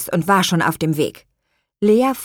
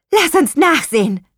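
high, louder eager cry; eager call; 11 semitones higher, 5 dB louder than usual. Acted. The twins decide together to go look, eager call. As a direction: call it out, ready to rush outside. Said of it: "riefen sie wie aus einem Mund".

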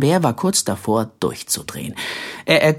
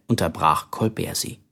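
ordinary delivery

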